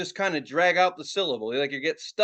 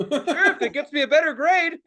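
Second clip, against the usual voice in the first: laughing; 8 semitones higher, 4 dB louder than usual. Retold or imitated. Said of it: "and I said".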